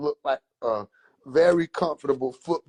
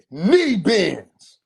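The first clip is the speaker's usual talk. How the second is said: curt order; 5 semitones higher, 6 dB louder than usual. Retold or imitated.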